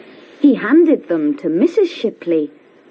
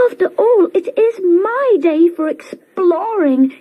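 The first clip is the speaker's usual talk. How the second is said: much higher, pleading little car; Brum to himself; 10 semitones higher than usual. A narrator's reading. Brum telling himself he deserves the trip, high and pleading. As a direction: insist to yourself with a whine. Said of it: "he told himself".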